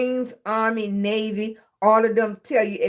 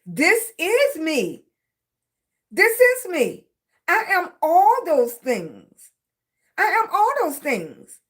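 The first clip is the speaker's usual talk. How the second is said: higher, drawn-out key words; emphatic insistence; 5 semitones higher than usual. Real, not acted. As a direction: declare it firmly, stretching IS and ALL high. Insisting on who she is.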